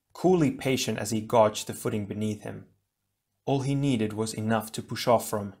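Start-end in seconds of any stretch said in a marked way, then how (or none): none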